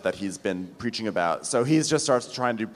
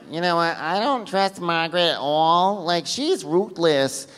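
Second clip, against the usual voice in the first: higher put-on voice, dragging at the end; Teresa; 5 semitones higher than usual. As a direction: mimic a distrustful woman confiding. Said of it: "Teresa's like".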